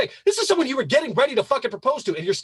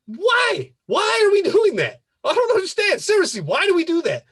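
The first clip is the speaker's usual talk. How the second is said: exasperated disbelief; 9 semitones higher, 3 dB louder than usual. Real, not acted. Exasperated rant about staying friends with exes.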